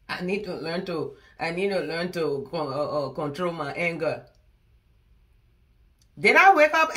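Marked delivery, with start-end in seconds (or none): 0.1-4.2 mock quoting her. Mocking her advice back at her.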